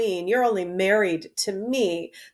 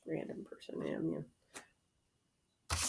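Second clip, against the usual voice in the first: throwaway whispered note; whispered aside; 7 semitones lower, 22 dB quieter, slower than usual. Real, not acted. Naming a minor speaker, whispered. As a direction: whisper it off to the side.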